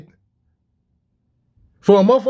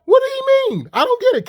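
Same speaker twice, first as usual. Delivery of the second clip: much higher, baffled and exasperated; incredulous disbelief. Real, not acted. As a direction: throw the question up high in disbelief. Baffled by the official's words.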